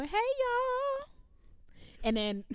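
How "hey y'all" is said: cheery greeting. Retold or imitated. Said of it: "You go".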